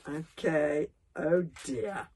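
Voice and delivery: ordinary delivery, nothing marked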